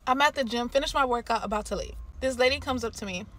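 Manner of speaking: usual speaking voice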